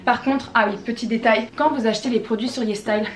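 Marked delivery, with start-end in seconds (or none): none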